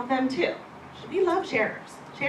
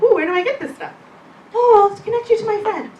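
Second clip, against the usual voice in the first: higher and louder, bubbly mock excitement; eager gushing; 10 semitones higher, 10 dB louder than usual. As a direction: play an overexcited coworker asking about oils. Acting out eager coworker and reply.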